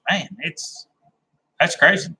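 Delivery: ordinary talk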